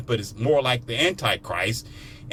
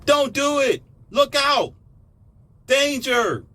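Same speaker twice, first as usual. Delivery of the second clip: frantic warning shouts; 13 semitones higher, much slower than usual. Retold or imitated. Warning the listeners again, frantic high shouts. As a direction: scream at someone heading for danger. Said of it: "That's all I kept telling you".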